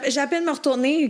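ordinary talk

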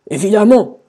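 loud grumpy retort; grumbled reply. A narrator's reading. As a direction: grumble it as a sulky king. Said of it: "grommela le roi". (réponse grommelée)

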